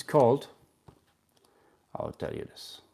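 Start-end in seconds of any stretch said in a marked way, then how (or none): 2.0-2.8 whispered aside. Real, not acted. Aside before naming the method.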